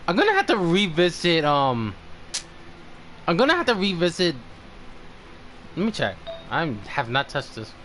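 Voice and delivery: ordinary delivery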